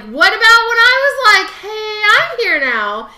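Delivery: high, loud mock wail; hammed-up aggrieved yell; imitated indignant protest. Acting out a character's indignant reaction.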